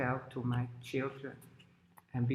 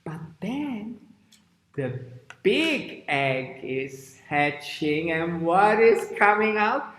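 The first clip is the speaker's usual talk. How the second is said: hushed start building to a loud high question; suspenseful story narration; 4 semitones higher, 7 dB louder, slower than usual. Shown as a demonstration. Teacher makes children guess what hatches, building suspense. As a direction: build suspense slowly, then lift the question.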